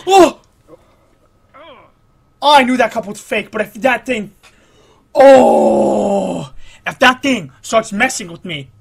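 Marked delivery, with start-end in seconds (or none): 0.1-0.4 short, high, loud "oh"; startled yelp. Real, not acted. Player startled mid-climb, yelping. 5.1-6.5 long, loud "ohhh"; loud drawn-out groan. Real, not acted. Player watching a slip, groaning loudly. Mishap in the game.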